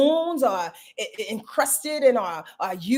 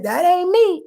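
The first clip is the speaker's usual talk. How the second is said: denying it; 8 semitones higher, 6 dB louder than usual. Retold or imitated. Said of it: "when we said".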